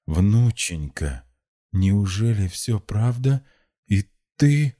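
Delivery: put-on slow, anguished old grandfather; slow disbelief climbing to a high last word; pained babble. A narrator's reading. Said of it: "лепетал болезненно Лучезар".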